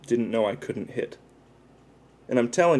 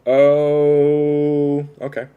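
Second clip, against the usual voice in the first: surprised; 4 semitones higher, 8 dB louder, much slower than usual. Real, not acted. Reacts to song pick.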